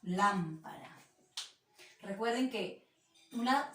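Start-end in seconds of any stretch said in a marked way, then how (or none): 0.0-1.0 Lámpara said slowly and lower; syllabified. Shown as a demonstration. Teacher reading the word to pupils, slowly. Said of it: "y escribo la palabra lámpara".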